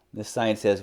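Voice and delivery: usual speaking voice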